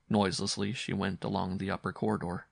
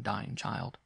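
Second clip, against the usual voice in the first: usual speaking voice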